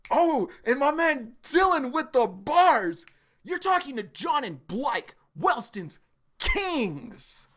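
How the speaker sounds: real fan excitement over the line; hype. Real, not acted. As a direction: savor the boast with a big grin. Hyped by Dylan's boast.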